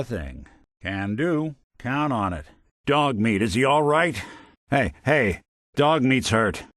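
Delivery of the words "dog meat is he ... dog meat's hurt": loud, worried calling out; urgent alarm. Acted. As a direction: call out in alarm over an injured companion. Companion dog is hurt in combat.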